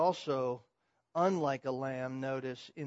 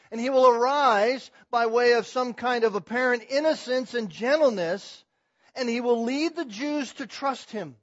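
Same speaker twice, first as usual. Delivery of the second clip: raised, ringing pulpit voice; loud, higher-pitched sermon delivery; forceful preaching; 7 semitones higher, 9 dB louder than usual. Real, not acted. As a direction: proclaim it loudly, like a prophecy to the congregation.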